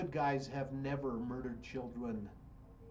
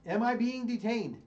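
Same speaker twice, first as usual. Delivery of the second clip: loud, high, stress on "I"; firm challenge; 7 semitones higher, 7 dB louder than usual. Shown as a demonstration. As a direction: demand it of the officer, loud. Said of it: "Be in".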